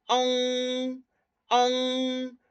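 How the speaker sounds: "ang" ending in a back nasal ng. Shown as a demonstration. Teacher showing the class the back nasal "ang". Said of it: "Ahora, nasal posterior".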